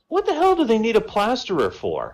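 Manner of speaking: high-pitched, incredulous punchline; baffled duck. Acted. Puzzled duck questioning the circus job offer, incredulous. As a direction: ask it in high, amused disbelief.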